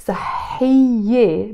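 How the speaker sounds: صحية drawn out to its final -iyye; feminine ending. Shown as a demonstration. Teacher explaining plural agreement, feminine ending drawn out. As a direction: stretch صحية so the -ye ending stands out. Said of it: "I have to use a feminine adjective".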